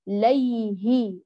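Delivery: "ليه" very slow, the y carried into hi; long, unbroken ay in "ليه"; flowing soft yaa. Shown as a demonstration. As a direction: sustain the glide of "ليه" up to the haa. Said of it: "اجعليه يجري إلى وصولك للهاء".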